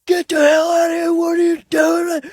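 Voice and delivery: high, loud mock shout through the car window; imitated freaking out. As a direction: yell it like the gunman losing it at the window. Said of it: "he starts freaking out from my back window".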